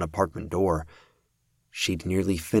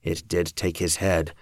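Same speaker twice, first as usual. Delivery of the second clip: ordinary delivery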